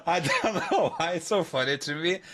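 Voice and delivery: normal talking voice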